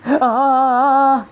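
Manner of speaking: high, drawn-out scream of fright; real startled yell, stretched out. Real, not acted. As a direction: scream from a jump scare. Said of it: "اتخضيت تاني".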